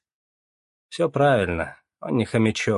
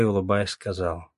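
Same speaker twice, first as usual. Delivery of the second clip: usual speaking voice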